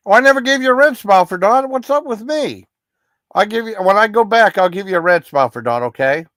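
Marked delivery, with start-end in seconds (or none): 0.1-4.7 much higher, louder oh of catching his own mistake; sudden realization. Real, not acted. Streamer realizing he never gave Dawn a role, surprised. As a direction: blurt it out as it dawns on you.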